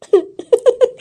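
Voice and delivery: high, teasing giggle. Acted. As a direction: laugh at her, high and mocking. Teasing laugh continues.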